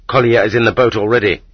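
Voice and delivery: ordinary delivery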